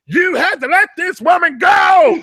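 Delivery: high, loud hammed-up shouting; over-the-top falsetto yelling for justice; mock hollering. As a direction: holler it like a staged protest. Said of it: "She's hollering".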